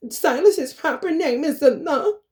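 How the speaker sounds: high, put-on correcting voice; mock over-specific. Mocking someone being all specific about a name, high taunting imitation. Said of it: "don't try to be all specific".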